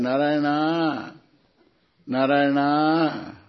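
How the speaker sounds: slow, drawn-out name call; calling one's son. Acted. A father in the joke calls his son, slow drawn-out calling. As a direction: call the name with long, stretched vowels. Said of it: "you will call your son".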